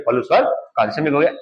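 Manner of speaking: normal talking voice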